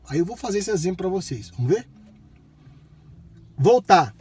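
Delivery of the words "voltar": loud clear command; talking to voice assistant. Real, not acted. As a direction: speak up to the car.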